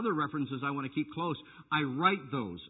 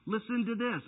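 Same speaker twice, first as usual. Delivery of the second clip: ordinary delivery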